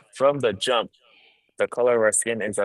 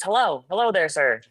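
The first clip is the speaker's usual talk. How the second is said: high, polite customer service voice; bright polite phone-agent greeting; 7 semitones higher than usual. Shown as a demonstration. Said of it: "a customer service voice".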